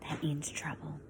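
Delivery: hushed aside to the class; whisper. Acted. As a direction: whisper it like a secret warning.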